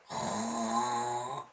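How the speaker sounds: deep rumbling snore; snore like a sleeping dog; a big sleepy snore. Acted. Dog snoring in the story.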